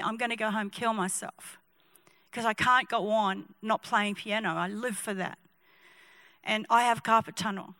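soft, low, understated despair; timid, tearful. Acted. Timid pianist confessing to young preacher, understated tearfulness. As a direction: quietly confess it with halting pauses. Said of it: "This timid guy comes down from the back of the shop and he's crying".

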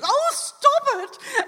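overwhelmed woman giving in to sobs; breaking down. Retold or imitated. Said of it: "she really lost it".

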